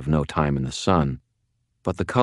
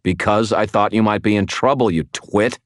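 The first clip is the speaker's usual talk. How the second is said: higher, exasperated holler; raised, irritated voice; 5 semitones higher, 4 dB louder than usual. Acted. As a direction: holler back in an argument. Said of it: "I hollered".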